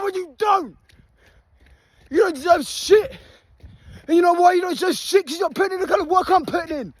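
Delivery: yelling rant, pitched high; furious shout. Real, not acted. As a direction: bellow it at the slackers. Ranting at lazy viewers mid-run.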